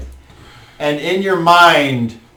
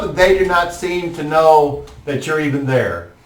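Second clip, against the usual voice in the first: usual speaking voice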